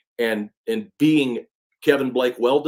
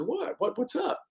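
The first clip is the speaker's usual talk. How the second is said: sudden much higher, puzzled retold what; baffled retold what; 13 semitones higher, 7 dB quieter than usual. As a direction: squeak out the confused what's up, imitating yourself. Retold baffled reply to stranger.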